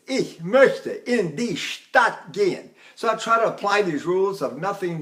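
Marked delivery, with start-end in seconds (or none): 0.1-2.7 "Ich möchte in die Stadt gehen" slowly, each word separated; busy articulation. Shown as a demonstration. Teacher showing how busy German is, slow separated "Ich möchte in die Stadt gehen". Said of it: "Look how busy that is".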